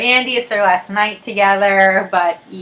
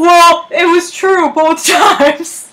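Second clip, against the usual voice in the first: mock-innocent boyfriend; 8 semitones higher, 3 dB louder, much slower than usual. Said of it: "he was like".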